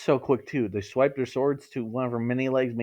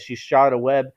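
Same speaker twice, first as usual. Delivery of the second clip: usual speaking voice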